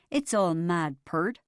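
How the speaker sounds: put-on slow, drawling Oklahoma accent; Dad practising a cowboy Oklahoma accent. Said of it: "said Dad in a strange voice".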